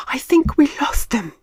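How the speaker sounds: Marty panting after the escape; out of breath. A narrator's reading. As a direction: gasp it out after running. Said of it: "as he caught his breath".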